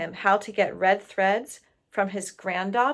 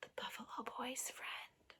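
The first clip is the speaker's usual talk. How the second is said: soft whispered answer; 3 semitones higher, 22 dB quieter than usual. Real, not acted. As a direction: whisper it shyly. Child answers quietly.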